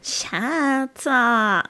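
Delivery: mock Twitch accent, long "Chaaat-aah". Shown as a demonstration. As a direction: call it in a Twitch accent, both syllables stretched. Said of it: "Adding "ah" after every, every sentence".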